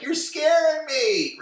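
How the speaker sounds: very high, slow hammed-up frightened cry; mock scared shriek. Brenda running from Dylan after the flower pot, mock shriek. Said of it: "Brenda runs off like".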